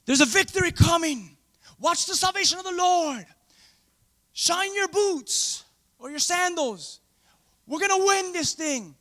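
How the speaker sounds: high, slow rallying promise; confident Moses. Retold or imitated. Moses promising the people victory, high and rallying. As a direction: build them up, sure of victory. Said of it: "What an encourager Moses".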